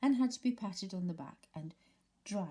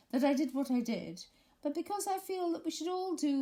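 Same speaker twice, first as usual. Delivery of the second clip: pompous Eeyore speech; 11 semitones higher, 6 dB louder than usual. A narrator's reading. Eeyore resumes his speech.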